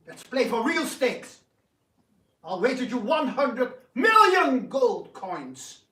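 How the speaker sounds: defiant wager. Acted. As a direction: throw down the bet. Raising stake defiantly.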